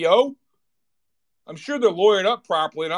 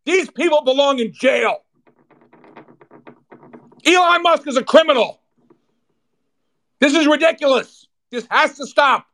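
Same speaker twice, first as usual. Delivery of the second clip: much higher and louder, shouting in real anger; furious rant; 8 semitones higher, 5 dB louder than usual. Real, not acted. Host raging at executives and lawyers, shouting angrily. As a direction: let it explode, yelling at the crooks.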